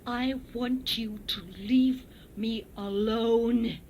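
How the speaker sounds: very slow, spluttering through salt water; drawn-out broken English; repeated broken English. A narrator's reading. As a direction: spit the words out slowly. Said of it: "she repeated, spitting mouthfuls of bothersome salt water".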